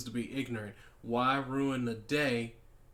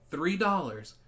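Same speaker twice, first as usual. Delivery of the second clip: "Three dollars" punched louder and higher; stress on dollars; 5 semitones higher, 5 dB louder than usual. Real, not acted. Speaker to viewers, mocking the petty price, "Three dollars" hit hard.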